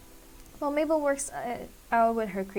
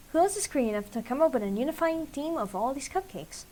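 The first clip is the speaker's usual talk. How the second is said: ordinary delivery, nothing marked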